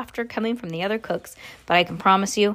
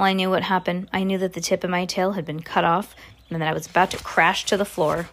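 usual speaking voice

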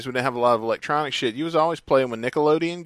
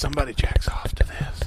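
whisper. Real, not acted. Muttered aside.

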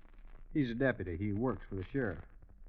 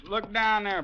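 higher and louder call; 9 semitones higher, 6 dB louder than usual. Acted. Billy calls out to Beaudry, spotting the men below the hill. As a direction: point it out from the hilltop. Calling attention to distant men.

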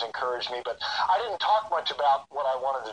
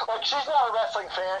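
ordinary delivery

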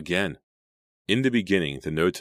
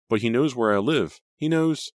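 high, louder, alarmed voice; frightened; 8 semitones higher, 5 dB louder than usual. A narrator's reading. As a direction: fear climbing higher on each phrase. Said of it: "She sounded very frightened".

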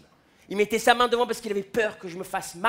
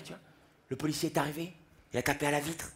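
ordinary delivery

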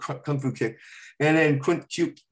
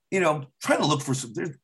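normal talking voice

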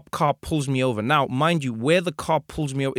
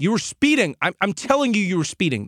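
insistent cop; 6 semitones higher than usual. Retold or imitated. Insistent cop voiced in story.

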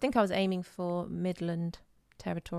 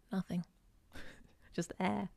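normal talking voice

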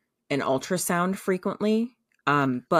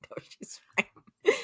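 casual dismissal; 4 dB quieter, slower than usual. Retold or imitated. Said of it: "And he goes".

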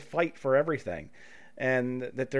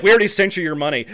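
fast, much louder breezy line; breezy rep; 3 semitones higher, 10 dB louder, faster than usual. Retold or imitated. Host voicing the Amazon rep, fast and loud. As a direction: zip through it cheerfully.

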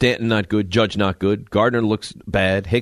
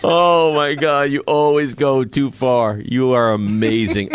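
laughing; 2 semitones higher, much slower than usual. Real, not acted. Still laughing at co-host.

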